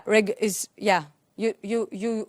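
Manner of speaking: normal talking voice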